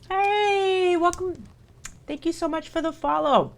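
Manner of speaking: high, friendly thanks to a new follower; bright greeting. Real, not acted.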